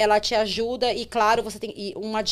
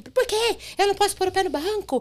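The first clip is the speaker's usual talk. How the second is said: passageira indignada; 9 semitones higher than usual. Retold or imitated.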